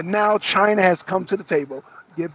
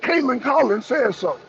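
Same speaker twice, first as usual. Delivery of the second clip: mocking gullible people; 6 semitones higher than usual. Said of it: "And so they were".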